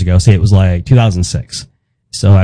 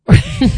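high laugh. Real, not acted. Laughs at own punchline.

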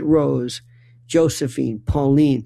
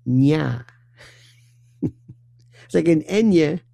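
usual speaking voice

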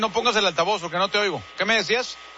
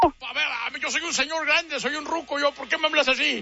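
put-on offended old man; 7 semitones higher than usual.

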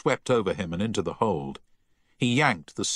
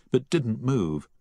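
usual speaking voice

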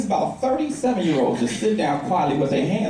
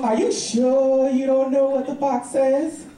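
put-on slow, raised child voice; insistent little niece; 5 semitones higher, slower than usual. Niece doubting her uncle's answer, slow higher child voice. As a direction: draw the question out slowly, pressing the adult.

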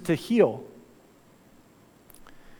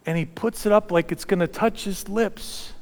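ordinary talk, nothing marked

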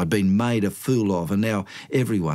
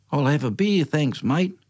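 ordinary delivery

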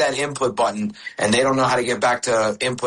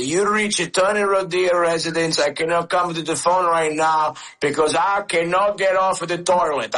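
higher voice of his dad, slowing toward the end; dad impression; 6 semitones higher than usual. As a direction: imitate dad's accented answering-machine greeting, pitch climbing. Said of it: "how much I can sound like him".

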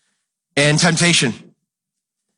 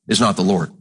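normal talking voice

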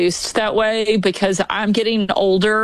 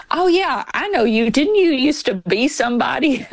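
quoting stranger; 5 semitones higher than usual. Retold or imitated. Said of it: "He goes".

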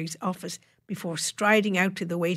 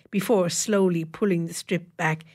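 ordinary delivery

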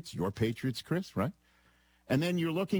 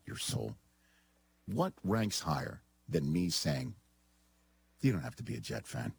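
low, measured, earnest-sounding delivery; mock solemn; 8 semitones lower than usual. Father asks son about his parenting, with mock solemnity. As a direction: lower the voice and spell it out gravely.

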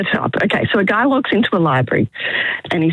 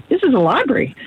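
voicing the librarian; 7 semitones higher than usual. Librarian answers in joke.

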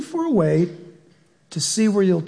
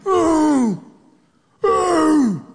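lion grunts; 7 semitones higher, 3 dB louder than usual. Pretend. Preacher imitates lion noise.

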